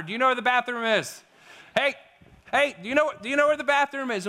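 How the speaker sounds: hammed-up shouting tourist; mock loud tourist. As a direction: repeat the bathroom question like a frustrated tourist yelling at a local. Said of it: "We get louder".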